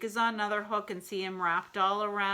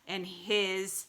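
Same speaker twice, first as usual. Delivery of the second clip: ordinary delivery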